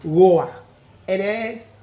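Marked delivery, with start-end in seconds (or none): 0.0-0.5 "roar" punched loud as the error; wrong roar. Shown as a demonstration. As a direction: stamp "roar" loudly as what not to say.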